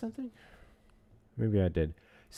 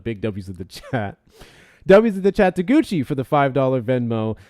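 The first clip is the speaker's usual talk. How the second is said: hype shout-out; 2 semitones higher, 10 dB louder than usual. Real, not acted. Shouting out donations.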